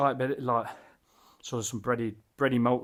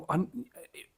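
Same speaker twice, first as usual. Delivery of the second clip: ordinary delivery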